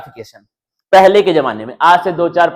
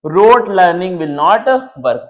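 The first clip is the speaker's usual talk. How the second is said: each word read slowly off the screen; slow reading aloud; much slower than usual. Shown as a demonstration. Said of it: "अब देखना क्या हो चुका है". (धीरे-धीरे पढ़कर सुनाना)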